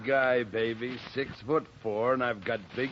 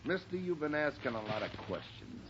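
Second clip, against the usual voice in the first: quiet menace; 4 semitones lower, 6 dB quieter than usual. Acted. Thug threatens detective before punch.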